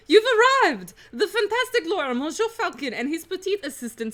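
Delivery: high, hammed-up French lady; delighted Dame Cataline. Prisoner hailing her lawyer's arrival, high and theatrical. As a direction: burst out high, welcoming them grandly.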